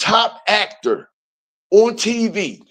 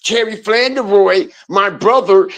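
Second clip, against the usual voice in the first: ordinary delivery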